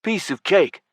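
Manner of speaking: high, overdone bravado; exaggerated vigor. A narrator's reading. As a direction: put on a big show of confidence. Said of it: "said with exaggerated vigor".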